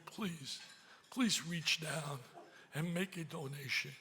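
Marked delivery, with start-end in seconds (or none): none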